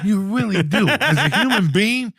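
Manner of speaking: high, loud angry rant. Retold or imitated. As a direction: tear into him, pitch way up. Ranting at the airport kid.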